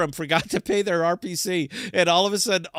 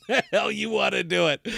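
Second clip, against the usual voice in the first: ordinary delivery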